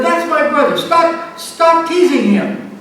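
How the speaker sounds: retold yell. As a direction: shout to make the bully stop. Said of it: "I yelled".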